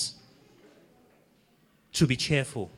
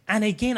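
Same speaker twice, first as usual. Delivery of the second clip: AGAIN pitched higher and louder; emphatic repeat; 8 semitones higher, 4 dB louder than usual. Real, not acted. Preacher stressing the verse to the church. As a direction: lift AGAIN high to stress it. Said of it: "I'm repeating, I'm emphasizing it".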